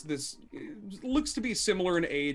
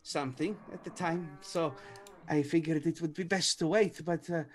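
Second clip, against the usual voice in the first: slightly raised pitch with long hesitant pauses; hesitant accented game character; 3 semitones higher than usual. Acted. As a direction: voice a polite introduction, feeling for the words. Game master voices Irina.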